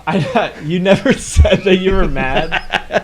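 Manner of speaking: high, loud, laughing through the words; can barely get it out for laughing; laughing hard. Real, not acted. Friend laughs at the driver's hidden anger, loud and high. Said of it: "I can't stop laughing".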